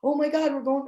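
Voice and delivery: high, thrilled dog voice; a touch of squealy dog excitement; excited dog. Retold or imitated. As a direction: voice the dog bursting with joy. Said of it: "she's like".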